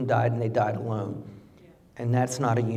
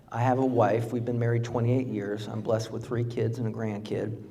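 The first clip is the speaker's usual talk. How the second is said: usual speaking voice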